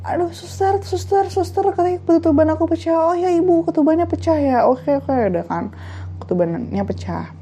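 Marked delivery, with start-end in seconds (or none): 0.1-3.1 high mock-pleading call to the nurse; thin, high, repeated wail; panicky patient. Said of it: "kata dia gitu". 3.1-5.1 lightly acted higher nurse voice; pitched-up, reassuring reply. Nurse answering the patient, acted.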